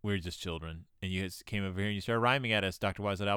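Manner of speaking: put-on low, quiet mumbling children; confused kids. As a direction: mutter it low like baffled kids. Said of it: "they're all just like".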